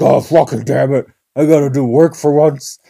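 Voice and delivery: loud, exasperated mock outburst; grumpy old douchebag. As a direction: grumble loudly like a fed-up worker. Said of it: "some old douchebag, hates his fucking life".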